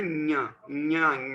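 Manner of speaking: ज्ञ, ज्ञ drawn out slowly as nya, nya; correct palatal nasal. Shown as a demonstration. Teacher modelling for pupils, slow ज्ञ as nya. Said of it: "इसका सही उच्चारण होता है".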